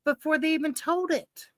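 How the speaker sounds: ordinary talk, nothing marked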